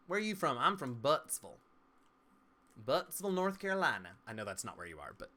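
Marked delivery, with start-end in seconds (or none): 0.1-4.1 put-on North Carolina Southern accent, pitched up; Southern drawn "-vul" endings, higher and louder. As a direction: play a loud Southern local naming their hometown. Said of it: "since I did it with that accent".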